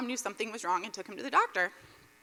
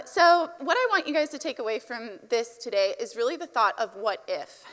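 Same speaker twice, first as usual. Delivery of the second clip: ordinary talk, nothing marked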